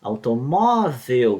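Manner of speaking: "automóvel" said slowly with the "mó" syllable leaned on; stress on MÓ. Shown as a demonstration. Teacher sounding out the stressed syllable for students, slowly. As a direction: draw out "automóvel", landing on "mó". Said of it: "tem o acento no O aqui".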